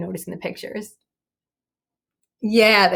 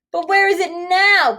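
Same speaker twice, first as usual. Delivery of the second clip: pleading; 10 semitones higher, 6 dB louder than usual. A narrator's reading. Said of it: "pleaded Stella".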